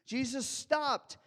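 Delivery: high, loud sermon climax; full-force preaching. Acted. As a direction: drive the story home to the congregation.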